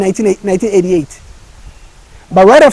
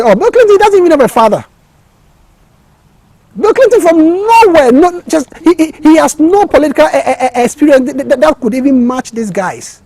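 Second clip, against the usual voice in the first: mocking advisors; 7 semitones higher than usual. Advisors' mocking talk about Clinton.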